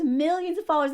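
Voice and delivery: normal talking voice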